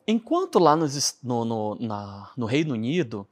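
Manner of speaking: normal talking voice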